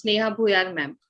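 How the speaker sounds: normal talking voice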